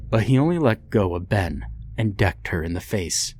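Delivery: plain reading voice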